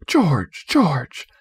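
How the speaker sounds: ordinary delivery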